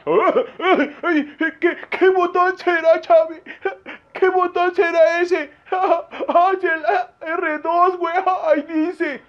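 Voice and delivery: high, whimpering fluster; hammed-up tearful panic; mock panic. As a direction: squeal helplessly as if about to cry. Mock panic over which button.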